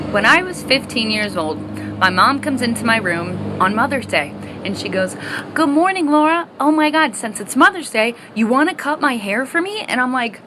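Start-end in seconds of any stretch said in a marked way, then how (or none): 5.5-9.8 higher, bright mock-mom voice asking; imitating mom. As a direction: play her mom, pitched up and sweet. Mom asks on Mother's Day.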